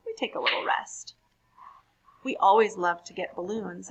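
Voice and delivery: lively, animated poem reading; reading to children. A narrator's reading. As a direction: read it brightly for a young audience. Reads poems to children.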